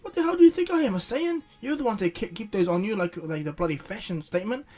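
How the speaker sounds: starts high and loud, cools toward the end; indignant rant. Retold or imitated. Bulma scolding Vegeta about the beans, high indignant rant. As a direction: snap back, offended, then wind down. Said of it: "And she goes".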